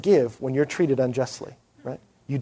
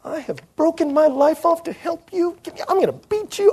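high-pitched, hammed-up frustrated teacher voice; exasperated mock outburst; 13 semitones higher than usual. A leader mocking his own frustration at murmuring students, in a hammed-up high voice. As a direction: jump way up in pitch like a fed-up leader about to snap. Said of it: "And you'll just wanna go".

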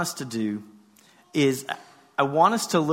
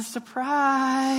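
higher, drawn-out weak "surprise"; sheepish surprise; 7 semitones higher than usual. Acted. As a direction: stretch it out like someone caught hiding. Caught hiding under the blanket.

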